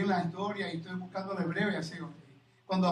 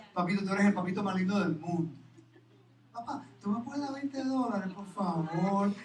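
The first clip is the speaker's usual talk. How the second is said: put-on sweet-talking child; 5 dB quieter than usual. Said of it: "decir cositas lindas a tu papá en el oído".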